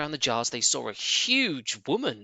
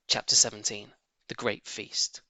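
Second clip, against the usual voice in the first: ordinary delivery, nothing marked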